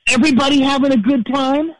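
high, stage-host call to the crowd; mock arena shout-out; rock frontman. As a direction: work the crowd like a rock singer. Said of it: "and he says".

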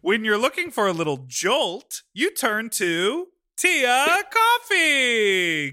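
big slow announcer delivery; announcer punchline. Acted. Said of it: "Get it?".

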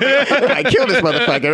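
high, laughing mock panic; half laugh, half dread. Laughing at his own doomed promise.

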